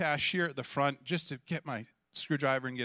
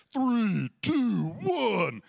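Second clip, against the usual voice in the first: loud, higher countdown; 6 semitones higher, 4 dB louder, much slower than usual. Retold or imitated. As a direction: count it down like the crowd. Crowd counts down.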